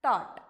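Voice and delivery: "thought" as THAWT, GH silent; model pronunciation. Shown as a demonstration. Teacher giving the next word, careful model pronunciation. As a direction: say "thought" as one firm word, no F. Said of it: "The next word here is".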